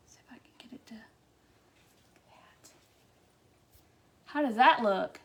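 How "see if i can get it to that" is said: slow, voiceless whisper to herself. Real, not acted. Crafter focused on the wreath, whispering slowly. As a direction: murmur it while concentrating, no voice.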